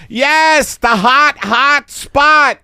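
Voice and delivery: high, loud insistent voice; gloating photographer. Acted. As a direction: relish ordering the big light. Photographer orders the spotlight.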